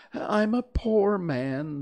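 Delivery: slow, high, nervous plea; high, drawn-out wail; pleading Hatter. A narrator's reading. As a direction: plead pitifully, drawing out the words. Hatter pleads with angry king.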